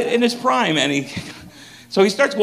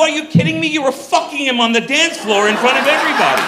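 incredulous friends; 8 semitones higher than usual. Retold or imitated. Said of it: "They go".

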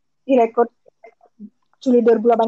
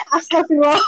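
sambil tertawa; 7 semitones higher than usual. Real, not acted. Tertawa mendengar klaim sombong.

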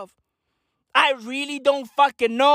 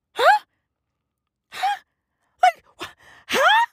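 huh?. Acted. Confused outraged huh.

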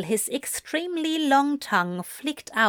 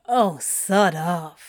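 slow, grudging grumble; grumbling; 3 semitones lower, slower than usual. A narrator's reading. Crowley conceding to Anthony, slow grudging grumble. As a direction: drag it out reluctantly. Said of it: "Crowley grumbled".

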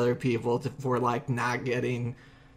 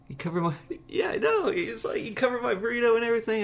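laughing in disbelief; 9 semitones higher, faster than usual. Real, not acted. Overwhelmed by stranger's kindness.

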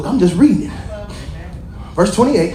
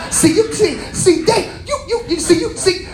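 a lightly raised preaching voice; preaching rebuke; 6 semitones higher than usual. Acted. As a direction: press the point home on the listeners.

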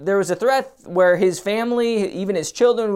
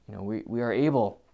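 usual speaking voice

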